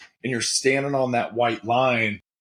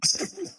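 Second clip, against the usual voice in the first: short laugh; 6 dB quieter than usual. Real, not acted. Reacting to being put on the spot.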